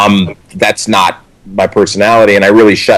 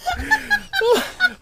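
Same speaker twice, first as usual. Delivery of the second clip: squealing high laughter; laughing at the memory; 12 dB quieter than usual. Real, not acted. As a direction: crack up. Laughing mid story.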